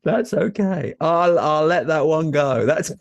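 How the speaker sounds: high, laughing reply; amused chuckling. Real, not acted. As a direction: let it go through chuckles. Host chuckles at co-host's joke.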